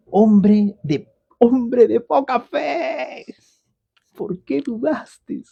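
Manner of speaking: high, slow and drawn out; admiring exclamation. Shown as a demonstration. Preacher voicing Jesus again, high, slow and admiring. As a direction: say it in wonder, not reproach. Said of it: "con signos de admiración".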